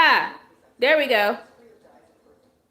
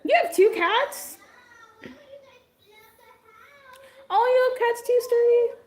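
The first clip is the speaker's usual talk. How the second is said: much higher, thrilled cat talk; excited squeal; 12 semitones higher than usual. Real, not acted. Streamer thrilled that a viewer has cats, excited. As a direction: squeal with delight over cats.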